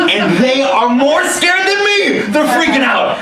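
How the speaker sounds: comic panic. Retold or imitated. Nervous jumper alarmed that soldiers are scared, panicked. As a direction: exclaim it in mock horror.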